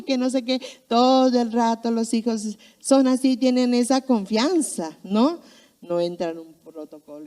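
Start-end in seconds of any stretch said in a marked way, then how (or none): none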